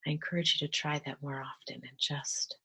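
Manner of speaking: soft, calm meditation voice with whispered stretches; gentle guided meditation, drifting into whispers. Acted. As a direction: ease them into stillness, half-whispering.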